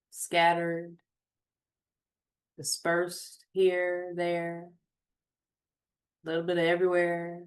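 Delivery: unhurried meditation voice with long pauses; guided meditation. Acted. Host guiding listeners to notice their surroundings, guided meditation. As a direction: guide them gently, phrase by phrase, leaving space.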